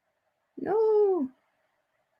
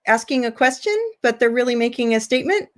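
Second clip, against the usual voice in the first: rising like a question on "question" and "statement"; uptalk; faster than usual. Shown as a demonstration. Teacher showing students how statements can sound like questions, uptalk. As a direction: make a statement but lift "statement" at the end like a question. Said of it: "Just like that".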